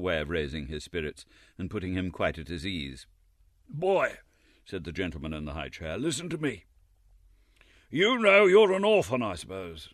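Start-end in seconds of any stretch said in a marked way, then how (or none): 3.7-4.2 pompous gentleman. A narrator's reading. Chairman addresses Oliver. 6.0-9.9 put-on lofty, condescending old gentleman; pompous gentleman. A narrator's reading. Chairman lectures Oliver.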